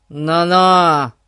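loud indignant warning; gruffly offended, loud objection. A narrator's reading. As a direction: cut him off in loud outrage. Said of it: "возмутился Гун".

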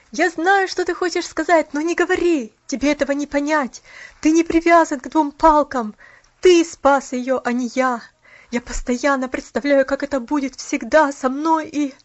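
heated protest that breaks off; impassioned outburst. A narrator's reading. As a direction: pour it out forcefully to a friend. Cuts friend off, bitter outburst.

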